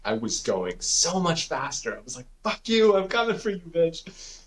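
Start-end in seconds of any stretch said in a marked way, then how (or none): 2.4-4.4 fired-up taunt. Retold or imitated. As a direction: replay taunting the finish line, pitch way up. Retold final sprint taunt to goal.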